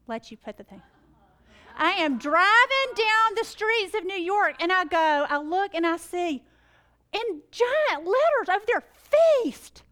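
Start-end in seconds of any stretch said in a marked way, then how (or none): 1.7-8.8 much louder and higher, slow and building; storyteller building to the big moment; rising excitement. Real, not acted. 8.9-9.8 much higher and much louder, stunned; seeing the answer in giant letters, astonished; astonished exclaim. Real, not acted. Sees the giant sign.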